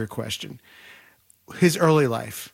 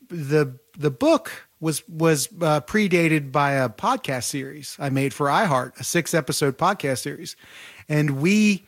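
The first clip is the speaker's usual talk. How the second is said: ordinary delivery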